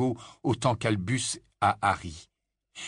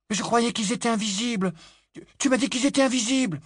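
put-on anxious boy; 12 semitones higher than usual. A narrator's reading. Albus anxious to brother.

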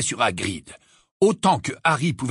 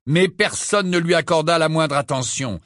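ordinary delivery, nothing marked

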